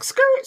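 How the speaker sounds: high, loud "skirt" screech; squealed record scratch. Retold or imitated. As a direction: imitate a record screeching to a halt. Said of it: "scratch record".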